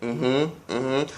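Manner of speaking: soft, calm hums; unbothered mm-hmm. Retold or imitated. Calmly brushing off the screaming sister, soft. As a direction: hum it softly, totally unfazed. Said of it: "I'm just looking at her like this".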